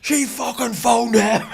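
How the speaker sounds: high, loud, drawn-out wail of blame; acted outraged protest. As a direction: shout it like a wronged teenager, hammed-up. Acted protest to angry dad.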